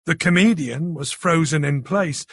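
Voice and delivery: plain reading voice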